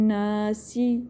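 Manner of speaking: ناسی with hummed n and brief "si"; short final vowel. Shown as a demonstration. Teacher corrects the overlong ending, ناسی finishing short. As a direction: let go of the end of ناسی after one beat. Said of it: "اتنا ہی time دیں گے".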